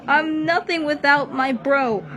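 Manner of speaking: high, loud, cracking yell; furious grief. Acted. Rabbit yelling at the monster for his lost brother, furious. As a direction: yell it, rage and despair together. Oswald rages at Turmoil.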